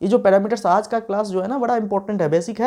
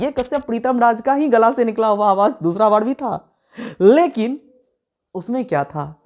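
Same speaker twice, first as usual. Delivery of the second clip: normal talking voice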